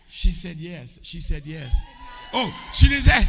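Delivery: excited announcement. Real, not acted. Crowd cheering after proposal.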